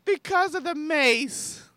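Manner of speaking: high, slow mock-drunk delivery; squeaky, drawn-out drunk lady; drunk woman. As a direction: drag it out like a tipsy woman. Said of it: "she's drunk".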